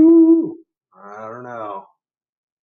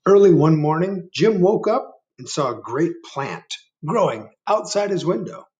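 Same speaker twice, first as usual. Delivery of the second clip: storybook reading; 7 semitones higher, 3 dB louder than usual. A narrator's reading. Reads story to children.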